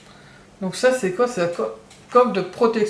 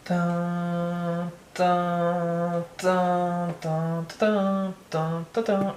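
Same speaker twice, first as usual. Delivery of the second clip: hummed fanfare; 3 semitones lower, 5 dB quieter, much slower than usual. Pretend. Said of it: "je vais quand même faire de A S M R".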